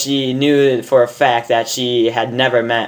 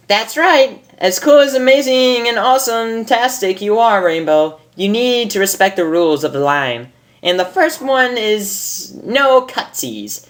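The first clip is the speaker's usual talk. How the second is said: high, bubbly pony voice, much higher; Pinkie Pie voice; 9 semitones higher than usual. Acted.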